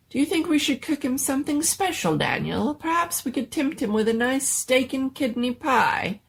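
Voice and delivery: high, fast fussing doctor; fussing mad doctor. Acted. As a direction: dote over the monster, quick and high.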